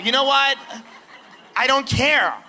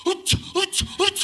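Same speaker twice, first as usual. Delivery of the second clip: straining grunts; 5 semitones higher than usual. Shown as a demonstration. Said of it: "You go like this".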